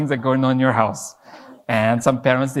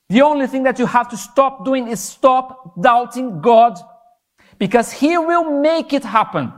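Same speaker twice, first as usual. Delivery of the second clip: higher, louder, slowed sermon build; fervent preaching; 6 semitones higher, 4 dB louder than usual. Acted. Preacher urges the youth to stop doubting, fervent preaching. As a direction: drive it home to the congregation with rising fervor.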